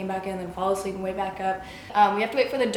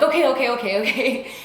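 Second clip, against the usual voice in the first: higher, repetitive mock placating; hurried placating; 3 semitones higher, 3 dB louder than usual. Nurses answering a patient in pain, hurried placating. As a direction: rattle it off to appease someone.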